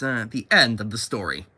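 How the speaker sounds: usual speaking voice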